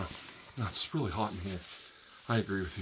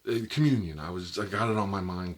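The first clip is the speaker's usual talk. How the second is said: flustered loud excuse; 8 dB louder than usual. Acted.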